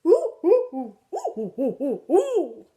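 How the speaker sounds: loud monkey hooting; playful imitated monkey "ooh-ooh". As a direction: hoot like an excited monkey, leaping in pitch. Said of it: "In your best monkey voice".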